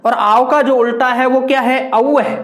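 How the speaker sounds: ordinary talk, nothing marked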